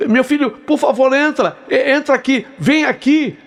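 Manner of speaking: high, pleading old woman, lightly voiced; a hint of a frantic grandmother calling out in relief; desperate grandmother crying out. Old woman at the door begging the stranger for help, high and pleading. Grandmother begs him to enter.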